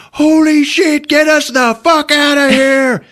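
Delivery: high, loud mock panic; hammed-up yelling at his friends to be pulled out; panicked yell. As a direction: scream for rescue in mock terror. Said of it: "Ergo is like".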